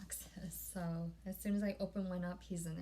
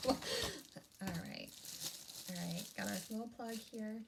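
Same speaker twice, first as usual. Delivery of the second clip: ordinary talk, nothing marked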